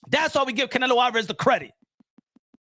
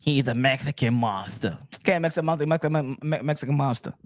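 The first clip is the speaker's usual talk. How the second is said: lower, mumbling mock of a fan; 8 semitones lower than usual. Streamer mocking fans who repeat the nickname, lower voice. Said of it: "mocking a fan's chant".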